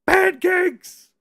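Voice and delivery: high, loud punchline shout; excited shout. Real, not acted. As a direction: yell the answer with glee.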